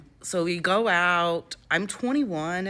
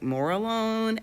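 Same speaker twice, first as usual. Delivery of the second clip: normal talking voice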